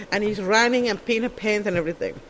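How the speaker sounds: ordinary talk, nothing marked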